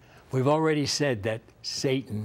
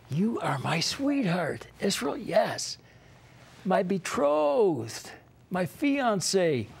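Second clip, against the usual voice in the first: higher, slow and tender, like a lover's vow; tender voice of God; 8 semitones higher, slower than usual. Acted. Preacher voicing God to Israel, tender and high. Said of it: "this is really intimate".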